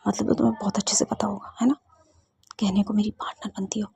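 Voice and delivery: soft, wounded whispered reproach; hurt whisper. Acted. Siya hurt, reproaches Ruhi quietly.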